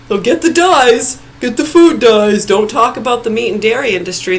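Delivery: furious yelling about food dyes; angry shout. Real, not acted. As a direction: bellow it out at the food industry. Rant peaks in shouting.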